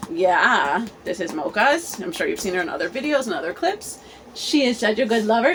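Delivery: normal talking voice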